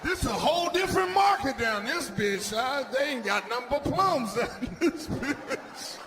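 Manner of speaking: hammed-up astonished shout laughing at the end; shocked exclaiming. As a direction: blurt it out in amazed disbelief. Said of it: "I'm like".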